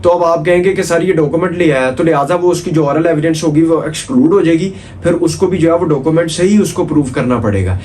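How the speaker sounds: ordinary talk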